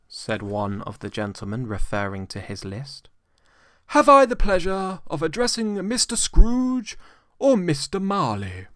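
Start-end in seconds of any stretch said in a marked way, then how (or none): 3.9-8.7 higher, courteous, lightly ceremonious; polite portly gentleman. A narrator's reading. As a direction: address the old man with formal politeness.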